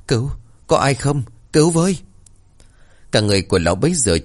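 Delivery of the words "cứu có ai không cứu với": high, desperate plea; drowning cry for help. A narrator's reading. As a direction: call for help in rising panic. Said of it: "Lão ú ớ".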